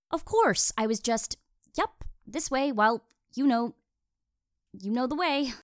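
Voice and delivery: high, halting, flustered babble. Acted. Flustered, pretending she was distracted.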